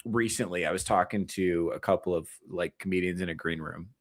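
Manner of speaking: ordinary talk, nothing marked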